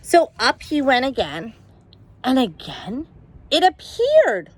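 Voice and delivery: usual speaking voice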